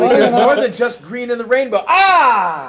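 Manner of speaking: high excited mock epiphany; hammed-up eureka moment; mock revelation.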